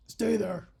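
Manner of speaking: mock deep-voiced kidnapper. Kidnapper ordering the captive to stay, deep voice. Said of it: "in a deep voice, he's like".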